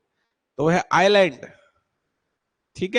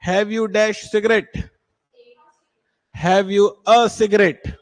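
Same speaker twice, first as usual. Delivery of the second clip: slow and a little louder; slow dictation; 3 semitones higher, 3 dB louder, much slower than usual. Shown as a demonstration. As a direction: spell it out slowly for the class. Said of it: "teacher reads exercise slowly". (धीमी, ज़ोरदार पढ़ाई)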